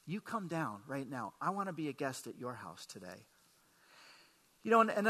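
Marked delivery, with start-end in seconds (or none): 0.1-3.3 softer, lower, calm invitation; gentle call. Retold or imitated. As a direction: speak it softly, like calling a friend down. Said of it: "and he's saying".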